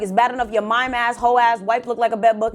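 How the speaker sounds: usual speaking voice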